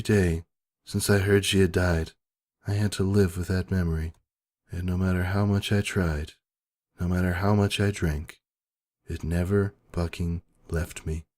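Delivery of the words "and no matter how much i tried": usual speaking voice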